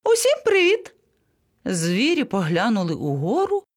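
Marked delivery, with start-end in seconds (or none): 0.0-0.9 high, chirpy little greeting; cheery monkey. A narrator's reading. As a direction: call down in a tiny high voice. Unseen monkey greets from above. (високим голосом мавпочки)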